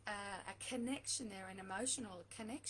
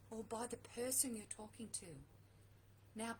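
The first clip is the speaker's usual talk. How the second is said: ordinary talk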